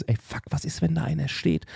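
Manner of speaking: soft, hushed alarmed thought; tense inner whisper. Retold or imitated. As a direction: think it to yourself in fright. Inner thought in scary moment.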